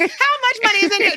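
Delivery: mock greedy question. Server jokes about a tip note, mock greedy. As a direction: tease about wanting cash. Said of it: "Speaking of quantity".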